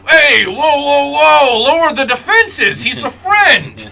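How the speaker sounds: high, loud, drawn-out call to stand down; urgent shout. Acted. Plor calls off the flames binding a friend, urgent shout. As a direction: holler it out high to halt an attack.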